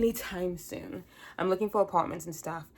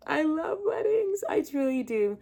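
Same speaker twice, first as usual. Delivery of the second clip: high, slow, teary wail; hammed-up sobbing, much higher; mock crying; 10 semitones higher than usual. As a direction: pretend to weep with joy at weddings. Wedding crier gushing.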